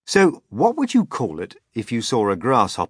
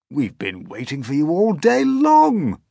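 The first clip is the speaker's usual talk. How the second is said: higher, louder grasshopper welcome; old grasshopper voice; 7 semitones higher, 7 dB louder than usual. Acted. Grasshopper welcoming James, higher and louder. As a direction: greet him like an old friend.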